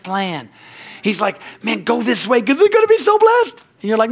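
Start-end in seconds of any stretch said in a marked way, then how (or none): 1.6-3.6 excited, high and loud; urging exclamation. Acted. God urging a believer to go his way, urging exclamation. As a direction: call it out with rising excitement. God urges in acted quote.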